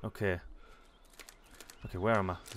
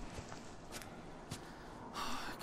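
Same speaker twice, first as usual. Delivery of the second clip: long sigh; 12 dB quieter than usual. Real, not acted. After stuck in puddle.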